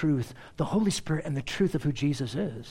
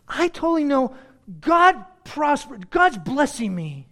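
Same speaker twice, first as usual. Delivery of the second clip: mock certainty; 7 semitones higher, 4 dB louder than usual. Preacher as Micah sure of God's blessing, mock certainty. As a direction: hammer out each word with hammed-up confidence.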